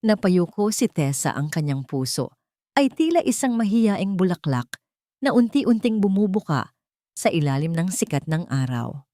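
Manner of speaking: plain reading voice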